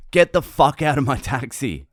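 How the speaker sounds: loud, high mock yell; retold angry outburst. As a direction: imitate a driver suddenly kicking off. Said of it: "he just kicked off".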